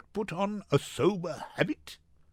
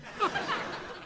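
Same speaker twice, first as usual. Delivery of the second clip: gleeful laugh; 6 dB quieter than usual. Acted. Lancelot delighted reading his palm.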